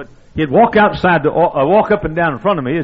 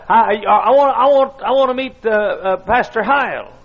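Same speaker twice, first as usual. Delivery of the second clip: put-on high, stammering old man; squeaky old voice; 8 semitones higher, 4 dB louder than usual. Old preacher asking for the pastor, squeaky. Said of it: "In a squeaky old voice".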